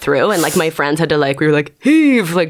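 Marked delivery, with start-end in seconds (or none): 1.8-2.3 higher, louder rallying heave; tug-of-war call. Retold or imitated. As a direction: call out the pull to friends.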